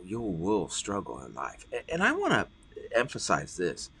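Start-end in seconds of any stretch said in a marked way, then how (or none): none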